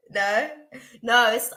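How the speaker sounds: ordinary delivery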